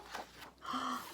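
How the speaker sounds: a sharp breathless gasp of surprise; surprised gasp. A narrator's reading. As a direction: gasp as if caught off guard. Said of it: "Little Beaver looked surprised".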